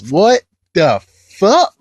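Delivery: slow, high, word-by-word disbelief; incredulous reaction. Real, not acted. Reviewer learning Tiffany is Alice's guardian, slow rising disbelief.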